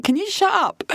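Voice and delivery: quick mock Irish accent, pitched high. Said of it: "I could do a little bit of Irish".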